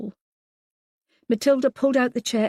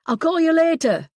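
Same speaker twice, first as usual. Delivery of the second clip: high, loud call while hurrying off; calling over shoulder; 10 semitones higher, 8 dB louder than usual. A narrator's reading. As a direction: toss it back over your shoulder. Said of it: "he said over his shoulder".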